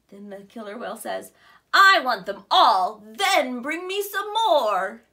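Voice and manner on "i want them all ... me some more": very loud, slow booming greed; greedy demand. Acted. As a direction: boom it out like a greedy giant. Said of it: "That's quite greedy of him".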